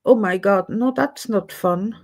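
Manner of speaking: ordinary delivery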